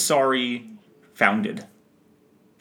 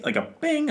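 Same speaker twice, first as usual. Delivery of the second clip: imitated bing. Imitates a jingle sound.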